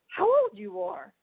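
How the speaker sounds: amazed question. Retold or imitated. Cop asks her age, amazed.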